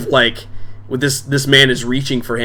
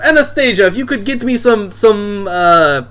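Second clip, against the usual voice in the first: boisterous bar owner; 8 semitones higher than usual. Acted. Drunk dad welcoming daughter's friends to his bar, booming. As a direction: play the drunk dad greeting guests expansively.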